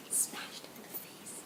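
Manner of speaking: slow, voiceless whisper; horrified whisper. Real, not acted. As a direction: whisper the awful climax. Hushed climax of the mishap.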